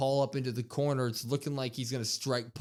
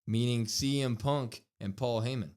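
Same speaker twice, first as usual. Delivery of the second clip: ordinary talk, nothing marked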